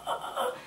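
exhausted groan. Retold or imitated. Crawling onto boat exhausted.

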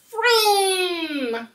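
high, excited engine noise; car vroom. Acted. Storytime host makes a car sound for children. Reading car book to kids.

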